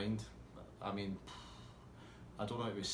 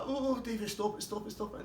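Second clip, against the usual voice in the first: pleading friend; 12 semitones higher than usual. Retold or imitated.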